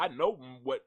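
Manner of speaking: normal talking voice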